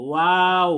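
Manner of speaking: loud, clear ওয়াও with round open lips; waw demo. Shown as a demonstration. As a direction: model ওয়াও for students, lips a finger apart. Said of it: "একটা আঙ্গুল ঢোকার মতো একটা ফাঁকা থাকবে".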